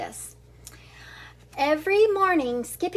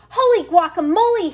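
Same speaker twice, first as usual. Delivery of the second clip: loud, high surprised exclamation; exclaimed; 5 semitones higher, 9 dB louder, faster than usual. Acted. Kitten startled by his reflection, exclaiming loudly. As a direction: burst out in amazement. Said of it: "he exclaimed".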